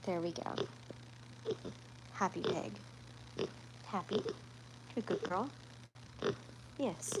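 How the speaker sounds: hushed praise for a happy pig; soft cooing. Real, not acted. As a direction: murmur gently to a contented pet. Soothing the pig in her arms.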